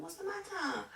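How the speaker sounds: high, soft, coaxing question; imitated concern. Teacher to a crying pupil, soft high concern.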